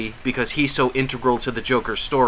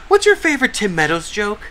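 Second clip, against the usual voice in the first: mock interviewer; 8 semitones higher, 4 dB louder than usual. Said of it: "it's like".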